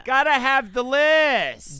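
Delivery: high, loud, drawn-out hype line; exaggerated show-intro call; segment hype. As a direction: belt it out like a jingle tag. Performed intro to list segment.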